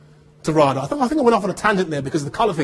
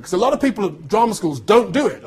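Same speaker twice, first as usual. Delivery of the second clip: ordinary talk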